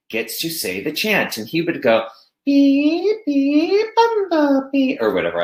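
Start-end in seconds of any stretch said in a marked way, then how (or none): 2.5-5.0 put-on much higher, slow child voice; child's voice. Student leading the chant in a high child's voice. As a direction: chirp it like a little kid. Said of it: "I'm making a child's voice".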